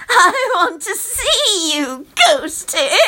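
high, slow, laughing call; creepy childish delight; gleeful calling. A narrator's reading. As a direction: coax the ghost out with a giggle. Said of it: "she calls out".

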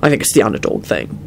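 low, thoughtful voice; musing. A narrator's reading. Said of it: "Quentin mused".

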